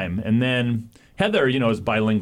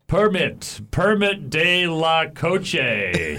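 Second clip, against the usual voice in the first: higher, very slow halting gringo Spanish; broken gringo Spanish; 5 semitones higher, much slower than usual. Retold or imitated. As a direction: grope for Spanish words as a clueless gringo. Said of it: "and I start going".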